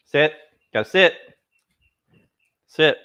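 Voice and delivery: firm dog command. Real, not acted. Commanding dogs to sit.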